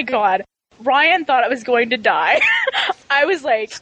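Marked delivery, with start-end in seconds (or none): 2.5-3.1 squealing burst of laughter; high cracking-up laugh. Real, not acted. Telling co-hosts how she lost it, a high squeal of laughter. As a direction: break into helpless laughter. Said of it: "cracking up".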